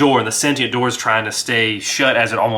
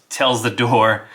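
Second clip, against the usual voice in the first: ordinary talk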